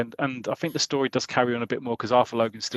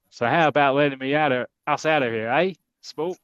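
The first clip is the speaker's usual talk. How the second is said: put-on American accent; 2 semitones higher, 3 dB louder than usual. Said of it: "He's gone Sm-, American again".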